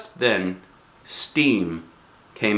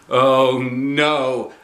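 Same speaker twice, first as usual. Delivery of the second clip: panicked oh no; 4 semitones higher, 5 dB louder than usual. A narrator's reading. Heart pumpy, panic.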